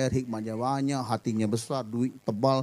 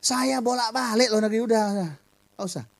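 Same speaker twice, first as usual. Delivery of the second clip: mock boast; 7 semitones higher than usual. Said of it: "Oh, kita misi ke luar negeri".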